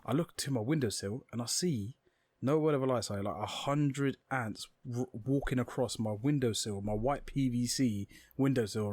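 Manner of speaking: ordinary delivery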